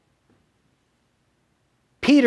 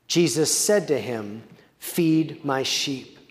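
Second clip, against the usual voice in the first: measured Bible reading, Jesus' words slowed right down; scripture reading; 4 semitones lower than usual. Acted. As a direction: read the passage aloud to the congregation, Jesus' lines unhurried. Reads John 21 aloud.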